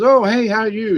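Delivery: lightly voicing the guy's friendly hello; cheery greeting retold. A man greeting a lady in the store, mock cheery. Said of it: "He goes".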